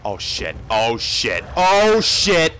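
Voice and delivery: real gamer panic, swelling on each repeat; low mutter rising to a yell; building alarm. Real, not acted.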